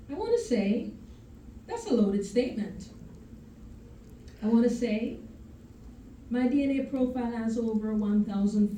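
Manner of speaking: emphatic spoken-word, rising and swelling through the images; spoken-word poem. Acted. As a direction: perform it as verse, pausing after each refrain. Recites her second poem.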